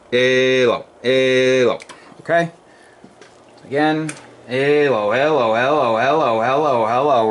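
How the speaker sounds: drawn-out radio hello. Real, not acted. Ham operator testing forward power, slow radio hellos. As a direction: stretch each hello over the air.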